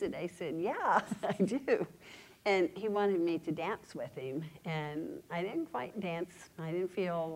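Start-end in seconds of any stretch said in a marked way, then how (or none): 0.6-1.9 a happy, slow laugh through the words; laughing yes. Retold or imitated. Retelling how she finally answered that she sees Jesus, laughing. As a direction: let joy spill into the answer.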